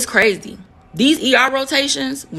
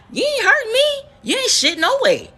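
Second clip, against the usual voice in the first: high-pitched dismissive comeback; imitated sassy clapback; mock defiant retort; 13 semitones higher than usual. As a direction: toss it back high and scornful, re-enacting the brush-off. Acted retort to her ex.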